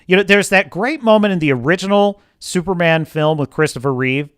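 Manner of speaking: usual speaking voice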